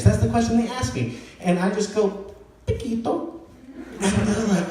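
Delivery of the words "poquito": carefully authentic Spanish pronunciation; one word in an earnest Spanish accent. Acted. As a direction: say it with your best Spanish accent. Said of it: "I say it as authentically as possible".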